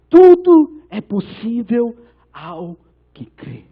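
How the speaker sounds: very slow, opening loud, phrase by phrase; Jesus's solemn answer. Retold or imitated. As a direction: draw it out with weighty pauses. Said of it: "Jesus fala pra ele". (resposta solene de Jesus, bem pausada)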